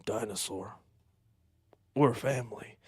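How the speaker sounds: low, soft, heavy Vin Diesel voice; Vin Diesel impression. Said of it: "Vin Diesel pops out and goes".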